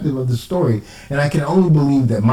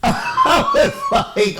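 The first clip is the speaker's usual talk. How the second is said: slow, high laugh before the words; much slower than usual. Real, not acted. Telling the story of the insult, laughing high and slow. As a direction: laugh in disbelief as you start.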